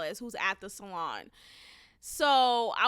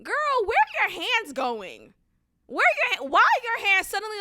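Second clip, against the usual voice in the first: higher, exasperated questioning; questioning the masseuse in higher, exasperated disbelief; indignant disbelief; 9 semitones higher, 5 dB louder than usual. Real, not acted. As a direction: ask where her hands are going. Addressing masseuse in complaint.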